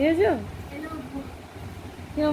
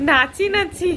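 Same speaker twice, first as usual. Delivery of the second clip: laughing sing-song; 7 semitones higher, 4 dB louder, slower than usual. Real, not acted. Mother laughing as grandpa bounces the baby. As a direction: giggle through the words.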